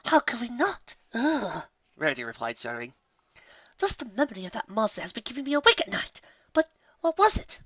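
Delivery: high, refined teasing; playful lady; posh lady voice. A narrator's reading. Rarity voiced.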